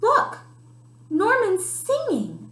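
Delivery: high, eager exclamation; astonished kid pointing something out; excited discovery. A narrator's reading. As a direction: pipe up in amazement, pitched high.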